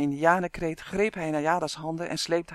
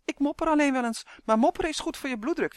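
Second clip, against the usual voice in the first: high, quick, cheerful elf chatter; beaming; 9 semitones higher, faster than usual. A narrator's reading. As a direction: rattle it off brightly, pleased with yourself. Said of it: "zei Uf stralend". (stralend en rap)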